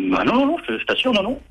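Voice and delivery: plus aigu, protestation nerveuse; dénégation gênée. Acted. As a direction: nie en bloc, un peu paniqué. Curé denies nervously in shower. (le curé se défend, voix nettement plus aiguë)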